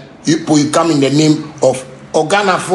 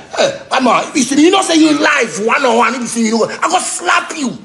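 high, half-laughing tough-guy threat; mock tough threat; 10 semitones higher than usual. Artist taunting a rival artist, mock threat. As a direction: taunt him like a street hard man.

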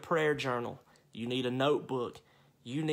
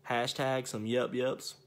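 normal talking voice

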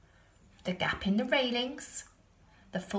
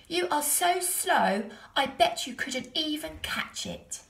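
put-on cheeky boy teasing; 7 semitones higher than usual. A narrator's reading. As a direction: tease the bear, drawing it out. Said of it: "said Stephen".

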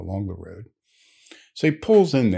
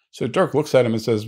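ordinary delivery

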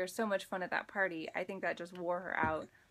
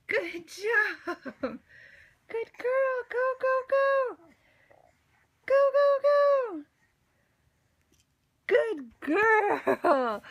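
much higher and louder, building to a near shriek; excited cheering; 10 semitones higher, 9 dB louder, slower than usual. Real, not acted. Cheering baby crawling to ring.

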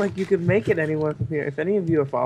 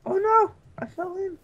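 startled. Real, not acted. Falls into water in game.

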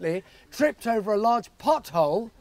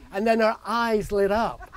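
ordinary delivery

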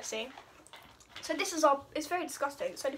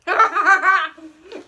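loud laugh; 5 semitones higher, 13 dB louder than usual. Real, not acted. Laughing at lemon flavour.

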